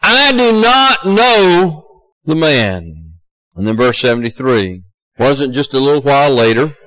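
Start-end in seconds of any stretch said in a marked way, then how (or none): 0.0-3.1 slow, higher-pitched, emphatic denial; solemn, drawn-out oath. Acted. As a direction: swear it word by word. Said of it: "he denied with an oath".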